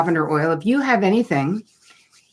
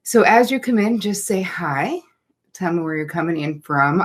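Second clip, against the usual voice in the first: ordinary talk, nothing marked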